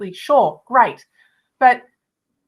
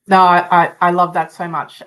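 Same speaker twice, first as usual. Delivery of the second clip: emotional; 5 semitones lower, 10 dB louder than usual. Real, not acted. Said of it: "it is emotional because it hits home".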